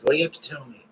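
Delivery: usual speaking voice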